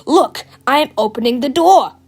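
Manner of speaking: loud, defiant demonstration; taunting. A narrator's reading. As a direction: show off loudly, daring them. Teacher loudly defies the warning.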